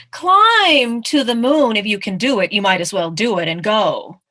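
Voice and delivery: emphatic storybook challenge; daring bird. Acted. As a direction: egg the mouse on. Bird dares the mouse.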